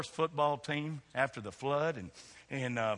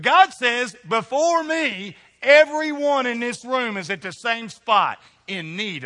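high, loud, slow proclamation; preaching at full force; 10 semitones higher, 12 dB louder, slower than usual. Acted. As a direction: proclaim God's words, full voice. Declaring God's word loudly.

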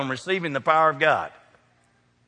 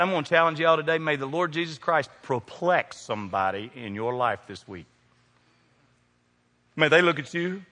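usual speaking voice

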